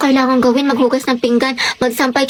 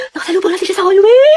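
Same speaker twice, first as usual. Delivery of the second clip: rising squeal at the end; kilig gushing; 3 semitones higher, 3 dB louder than usual. Acted. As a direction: squeal about passing your crush. Gushing about meeting crush. (kinikilig, patiling pagkukuwento)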